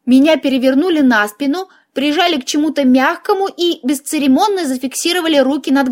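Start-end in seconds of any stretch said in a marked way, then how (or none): none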